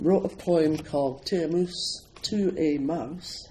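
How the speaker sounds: ordinary talk, nothing marked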